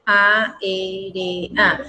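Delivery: "A, E, R, A" stepping downward as a, e, re, a; separated syllables. Shown as a demonstration. Teacher repeating for the class, syllables separated. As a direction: spell out "A, E, R, A" in four falling beats. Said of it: "Me la separan".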